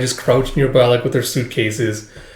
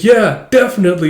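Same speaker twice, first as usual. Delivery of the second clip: higher, louder eager yes; eager agreement; 7 semitones higher, 5 dB louder than usual. Retold or imitated. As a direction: agree with a bright punch.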